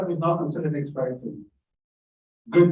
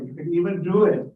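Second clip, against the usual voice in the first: ordinary delivery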